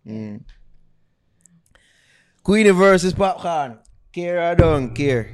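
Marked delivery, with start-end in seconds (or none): 2.4-5.3 announcer. Acted. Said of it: "announces a segment".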